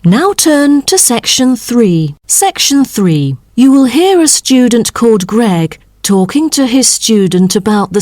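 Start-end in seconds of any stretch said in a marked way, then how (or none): none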